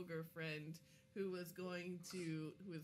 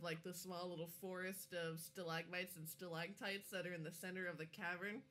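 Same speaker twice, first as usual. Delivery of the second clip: ordinary talk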